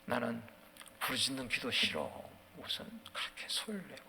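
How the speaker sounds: low, soft grumble; quietly voiced disapproval; grumbling objector. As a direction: mutter a complaint under the breath. Voices an objector to loud prayer.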